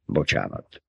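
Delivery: gruff low apology; muttered apology. A narrator's reading. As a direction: mutter a quick sorry. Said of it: "azt morogta".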